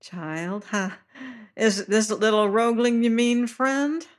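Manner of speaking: smiling high-voiced fat seaman; high-pitched voice. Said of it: "smiling yet".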